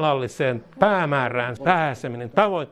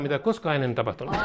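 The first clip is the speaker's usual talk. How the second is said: ordinary talk, nothing marked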